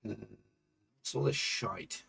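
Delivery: a soft, slow, whispered grumble of "what's all this shite?"; a quiet, slow whisper of disbelief; whispered aside. Real, not acted. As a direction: whisper it to yourself in disgust. Muttering at the emails.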